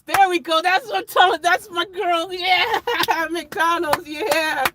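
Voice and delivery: real excitement. Real, not acted. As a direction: celebrate the winner like a fan. Cheering his chat's McDonald's pick.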